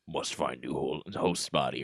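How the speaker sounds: low, menacing demon voice; demonic voice. Retold or imitated. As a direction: growl it like a possessing demon. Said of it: "in a demonic voice".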